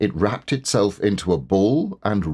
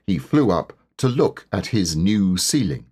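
ordinary delivery

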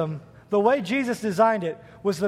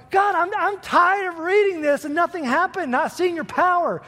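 high, frustrated complaint to God; angry plea; 9 semitones higher than usual. Retold or imitated. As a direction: vent the frustration upward. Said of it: "I'm getting angry".

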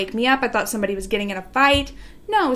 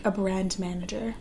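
whispered, softer; 4 semitones lower, 9 dB quieter than usual. Real, not acted. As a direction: hush it like a secret. Whispering an admiring aside.

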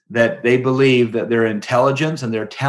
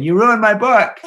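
higher, louder mock accusation from the grave; ghostly dad scolding; 12 semitones higher, 6 dB louder than usual. To his son from the grave, mock-scolding loudly. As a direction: play the dead father scolding, loud and high. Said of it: "my dad haunting me from his grave saying".

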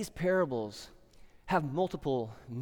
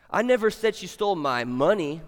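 final "money" hit hard; stress on MONEY; 5 dB louder than usual. Shown as a demonstration. Preacher showing the last of seven meanings, stress on "money". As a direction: save the punch for "money" at the end. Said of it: "what word you emphasize".

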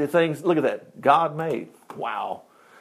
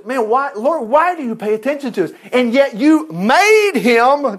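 awed psalmist; 8 semitones higher, 4 dB louder than usual. Retold or imitated. Voicing David's awe.

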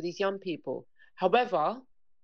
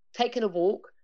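normal talking voice

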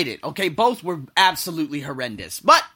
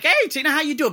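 high, louder hello; upbeat greeting; 10 semitones higher, 5 dB louder than usual. Retold or imitated. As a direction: greet her with cheery energy. Acted greeting to Tina.

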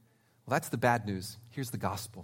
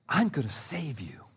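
soft unvoiced promise; tender whisper; 4 semitones lower, 4 dB quieter than usual. God's tender promise voiced.

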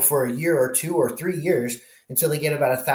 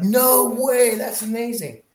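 much higher, thrilled disbelief; amazed; 10 semitones higher, slower than usual. Retold or imitated. Pilot hearing he'll fight fires in California, amazed. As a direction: let the news knock you over. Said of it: "I'm like".

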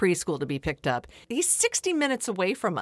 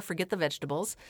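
ordinary talk

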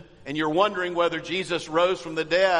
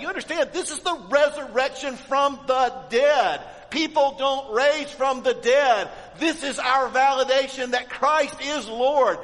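higher and louder, pulpit fire; fervent preaching; 7 semitones higher, 3 dB louder than usual. Acted. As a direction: preach it with rising conviction. Preacher builds to climax.

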